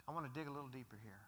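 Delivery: hurried aside. Real, not acted. Preacher hurrying past to the next point, fast and soft. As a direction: rush through it under the breath.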